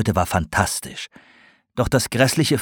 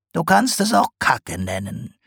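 dry potter joke; 7 semitones higher than usual. A narrator's reading. Sarg's blunt joke.

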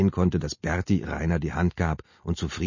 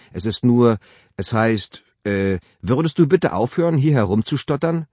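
gereizt; 5 semitones higher, 6 dB louder than usual. Acted. Sabine zankt ihren Mann an.

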